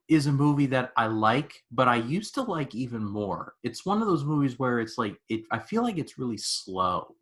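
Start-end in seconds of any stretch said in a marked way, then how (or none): none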